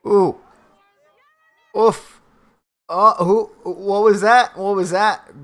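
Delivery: loud, startled gasps and questions; shocked. Real, not acted. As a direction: react loudly, caught off guard. Shocked reaction to clip.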